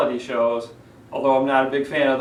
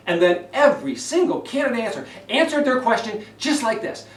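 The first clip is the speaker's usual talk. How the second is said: higher, strained and driving; tense, high voice; 7 semitones higher than usual. Shown as a demonstration. Coach mimicking candidates answering, high and tense. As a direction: push the words up with tension. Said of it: "Notice the tension in my voice".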